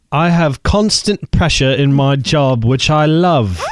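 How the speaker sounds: a poor attempt at a British accent reading a letter; bad British accent. Host reads a UK listener's letter in a bad British accent. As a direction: read it in a shaky put-on British accent. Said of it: "That was a terrible accent".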